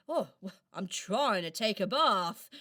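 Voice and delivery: ordinary delivery, nothing marked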